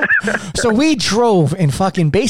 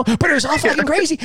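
high repeat; 10 semitones higher than usual. Real, not acted. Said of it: "I shouldn't have said that word".

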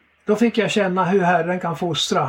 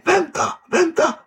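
shaken pleading; 9 semitones higher than usual. Retold or imitated. Shaken man on the phone.

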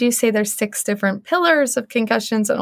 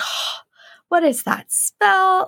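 high, drawn-out retold disgust; retold over-the-top complaint, slow and high-pitched; overreacting to a smell; 8 semitones higher, much slower than usual. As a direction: recoil from a smell, stretched out and high.

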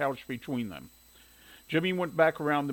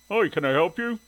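higher, louder man's greeting; hailing stranger; 5 semitones higher, 6 dB louder than usual. A narrator's reading. Man in overalls approaching a visitor, hailing her. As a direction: hail the visitor helpfully.

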